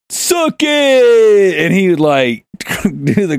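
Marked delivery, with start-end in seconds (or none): 0.1-1.6 high, loud, long-held imitated yell. Tony yelling through the school hallways, mock yell. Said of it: "he would yell it through the hallways".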